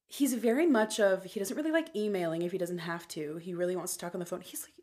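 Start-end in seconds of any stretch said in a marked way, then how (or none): 4.4-4.7 whispered aside. Real, not acted. Aside about his age.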